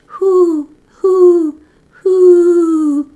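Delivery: high, loud owl hooting. A narrator's reading. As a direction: hoot long and high like Mother Owl. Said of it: "and hooted".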